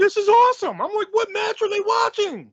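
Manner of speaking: very high, exasperated disbelief; incredulous outburst. Retold or imitated. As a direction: protest in baffled disbelief. Incredulous about crowd's chant.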